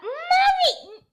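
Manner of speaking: high, loud laughing shout. Real, not acted. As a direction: squeal it out through laughter.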